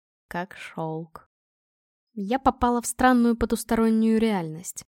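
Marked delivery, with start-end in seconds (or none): 0.3-1.3 very soft, low, savouring murmur; exhaled murmur. A narrator's reading. Man marvels at her skin, exhaled murmur. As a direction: breathe the words out quietly. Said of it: "выдохнул он".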